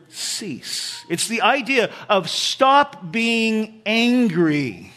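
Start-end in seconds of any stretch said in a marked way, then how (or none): 0.1-1.0 "cease" set apart, hushed and drawn out; stressed "cease". Shown as a demonstration. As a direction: lean on the word "cease", soft and slow. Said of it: "notice those words".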